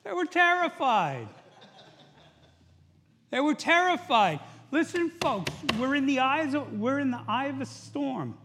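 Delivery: loud, high exhortation; impassioned preaching. Real, not acted. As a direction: preach it out with urgent force. Preacher hammers the point loudly.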